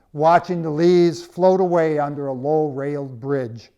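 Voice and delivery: ordinary delivery, nothing marked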